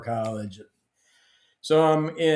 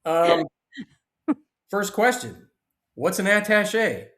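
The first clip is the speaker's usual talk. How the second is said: higher, innocently clueless ask; a hammed-up wide-eyed newbie; mock naive question; 8 semitones higher, 4 dB louder, slower than usual. Asking the attaché what the job even is, mock naive. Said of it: "I said".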